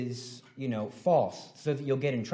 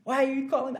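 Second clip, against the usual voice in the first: put-on higher, whiny kid protest; a hint of an offended kid; lightly voiced indignant student; 10 semitones higher, 4 dB louder than usual. Kid's indignant reply.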